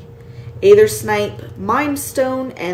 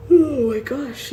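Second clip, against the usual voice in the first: high yawn through the words; yawning mid-sentence, tired; 5 semitones higher than usual. Real, not acted. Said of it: "yawning so much".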